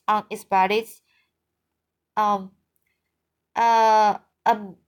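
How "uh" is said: plain reading voice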